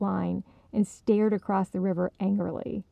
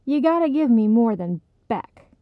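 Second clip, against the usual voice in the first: louder, pressing demand; insistent; 4 semitones higher, 7 dB louder than usual. Acted. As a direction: insist hard. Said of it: "Esther insisted".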